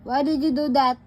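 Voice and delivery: usual speaking voice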